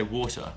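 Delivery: "water" with its t sounded again; London-changed "water", t fully pronounced; present-day "water". Shown as a demonstration. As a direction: say "water" with a clear middle t. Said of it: "But now I say".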